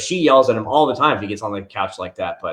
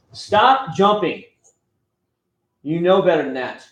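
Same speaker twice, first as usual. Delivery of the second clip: stern scolding; 5 semitones higher than usual. Real, not acted. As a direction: draw the words out to stop him. Scolding his jumping child.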